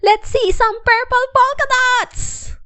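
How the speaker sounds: put-on high, loud kid's yell; a taunting schoolkid calling out; high, loud teasing child shout. A narrator's reading. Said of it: "One yelled out".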